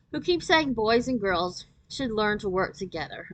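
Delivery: plain reading voice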